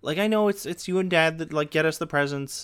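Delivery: high, earnest mock little girl; little sister calmly explaining to mom; kid sister voice. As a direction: pipe it up like a knowing kid. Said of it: "my sister's like".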